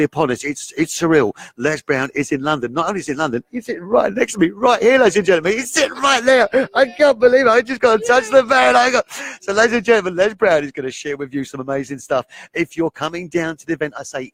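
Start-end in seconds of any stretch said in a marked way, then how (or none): 3.5-10.6 thrilled. Real, not acted. Host thrilled, guest beside him.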